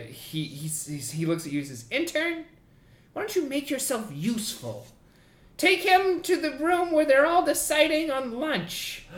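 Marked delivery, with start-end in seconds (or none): 1.9-9.0 much higher, curt command; a snippy boss giving orders. Acted. Boss ordering the intern, high and curt.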